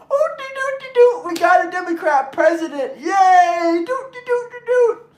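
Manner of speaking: very high silly singsong cheer; hammed-up high doo-doot cheer; mocking carefree singsong. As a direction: sing it out like a giddy fool. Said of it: "You said".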